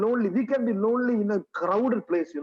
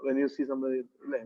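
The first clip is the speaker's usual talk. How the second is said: ordinary delivery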